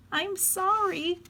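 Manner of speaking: put-on small, very high-pitched child voice; apologetic child. A narrator's reading. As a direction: squeak out a sheepish apology as little Harriet. Child apologising after escalating scolding.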